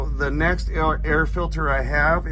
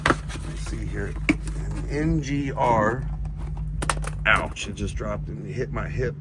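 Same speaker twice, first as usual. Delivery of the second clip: strained voice lying under the truck; lying under a car; 3 semitones lower, 3 dB quieter than usual. Real, not acted. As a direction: talk while reaching under a truck. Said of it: "I'm under the truck here".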